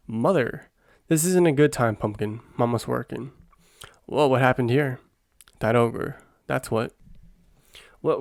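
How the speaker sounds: normal talking voice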